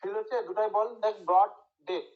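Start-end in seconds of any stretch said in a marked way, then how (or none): 1.3-2.1 broad, pause, ডেথ, jaw dropping on each; stress on both words. Shown as a demonstration. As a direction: separate broad and ডেথ with full weight on both. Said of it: "দুটাই বলার সময় দেখ চোয়ালটা নিচে".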